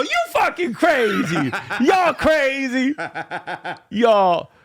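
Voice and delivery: slow, laughing high mock Miss Pat voice; imitating Miss Pat. Imitating Miss Pat laughing at her guests, slow and high. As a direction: drag out y'all crazy through laughter, high and slow. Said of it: "Just listen to me and Miss Pat".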